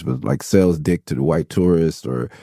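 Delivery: ordinary talk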